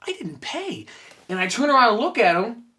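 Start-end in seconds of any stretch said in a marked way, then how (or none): 0.1-0.9 much higher, softer gasp of realization; the thought hits you mid-step; sudden realization. Retold or imitated. Fan realizing he walked off without paying, hushed surprise.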